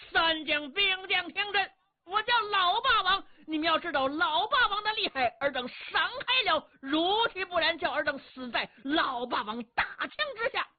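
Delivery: high, ringing shout at the troops; boastful warrior shouting at the enemy ranks; loud bluster. Acted. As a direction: bellow threats to clear the way. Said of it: "高声喝喊".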